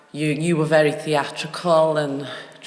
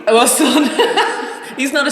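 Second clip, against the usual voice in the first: louder, high burst of laughter; loud laughing; 10 semitones higher, 10 dB louder than usual. Real, not acted. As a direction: laugh it out, caught out. Caught out, laughing loudly.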